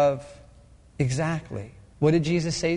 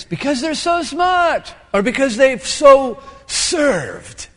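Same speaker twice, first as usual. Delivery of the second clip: slow, loud, higher, hammed-up pride; arrogant boast; 7 semitones higher, 6 dB louder, much slower than usual. Preacher mocking the proud person in church, slow and loud. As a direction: drag it out like a puffed-up show-off.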